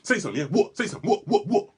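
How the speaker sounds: crazy aggressive act. Acted. Confronting the rude driver, loud aggressive act. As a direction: get in his face like you're crazy. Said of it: "Great actor".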